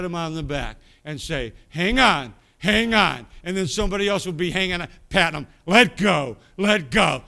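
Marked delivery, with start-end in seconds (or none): 1.7-3.3 loud imitated "hang on" from altar workers; mimicked shout. Church workers urging a kneeling sinner, loud mock shout. 5.7-7.2 loud imitated "let go" from altar workers; mimicked shout. Church workers urging a kneeling sinner, loud mock shout.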